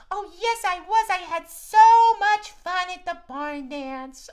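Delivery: high puppet cat. Acted. Max the cat greets the kids, high puppet voice. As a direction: squeak it out like a little cat.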